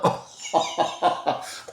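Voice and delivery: high burst of laughter; laughing. Real, not acted. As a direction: crack up in quick bursts. Laughs at the politician's name.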